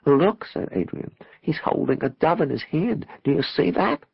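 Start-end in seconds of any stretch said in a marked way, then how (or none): 0.1-0.4 high, loud exclamation; excited call. A narrator's reading. Pointing excitedly at the clouds. 1.4-4.1 high-pitched, marvelling voice; excited wonder. A narrator's reading. Excitedly describes vision in clouds.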